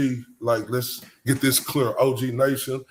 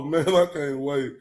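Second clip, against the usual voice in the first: usual speaking voice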